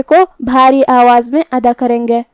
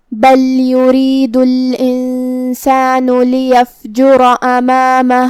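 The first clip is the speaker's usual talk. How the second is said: slow, chanted tajweed recitation; Quran recitation; much slower than usual. Acted. Teacher reciting verses for the students. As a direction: recite the verses slowly and melodically.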